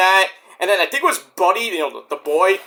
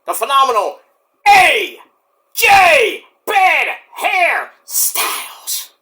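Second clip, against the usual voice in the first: higher, very slow drawn-out shouted intro; ring announcer; 8 semitones higher, 5 dB louder, much slower than usual. Pretend. Introducing AJ to the crowd, drawn-out announcer shout.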